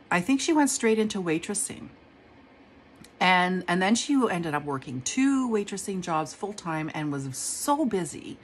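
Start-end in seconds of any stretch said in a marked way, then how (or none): none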